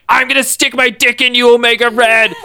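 high, hammed-up macho threat; mock Logan. Logan taunting Omega Red, high mock bravado. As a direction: play Logan as a squeaky tough guy. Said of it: "Logan's like".

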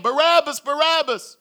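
high, insistent crowd call; crowd shouting a name. Acted. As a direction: shout the name as a mob would. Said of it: "you hear them shouting your name".

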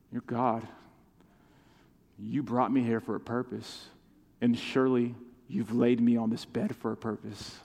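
quiet, low prayer to God; softly surrender, talking privately to God; subdued prayer. Retold or imitated. Preacher retelling his prayer from his sickbed, quiet and humble. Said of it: "I had to say, on my back".

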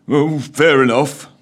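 slow grumble; weary, slow mutter. A narrator's reading. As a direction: Scrooge grumbling back at the ghost. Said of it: "muttered Scrooge".

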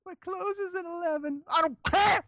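put-on sassy teen girl. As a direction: talk back like a defiant teenager. Teen voice talking back.

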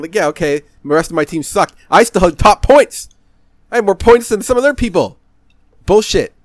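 loud, high indignant protest; heated rant. Real, not acted. Heated defensive rant about match.

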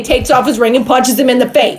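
normal talking voice

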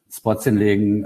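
ordinary delivery

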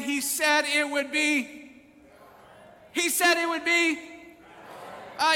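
insistent call-and-response climax; full-force preaching. Real, not acted.

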